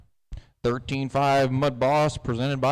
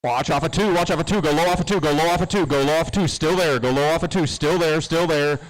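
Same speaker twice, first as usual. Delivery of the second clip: urgent raised calls, faster; race spotter radio; 3 semitones higher, 4 dB louder, faster than usual. Real, not acted. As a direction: call it out to the drivers. Spotter urging drivers on radio.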